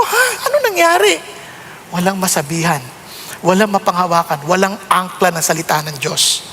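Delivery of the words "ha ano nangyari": shocked. Retold or imitated. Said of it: "Sabi ko".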